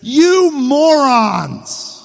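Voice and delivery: high, loud, drawn-out yell; acted exasperated shout; angry temper. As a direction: hurl it as a stretched-out insult. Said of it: "Moses' haste and frustration and his temper".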